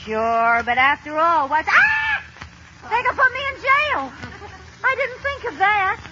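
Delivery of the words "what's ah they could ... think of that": sudden high panicked realization; comic alarm at the thought of jail. Acted. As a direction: shriek as it dawns on her. Realizes she could go to jail.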